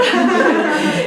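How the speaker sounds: short burst of real laughter. Real, not acted. Speaker laughing at her own joke, a short real laugh. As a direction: laugh at your own threat. Laughs after joking threat.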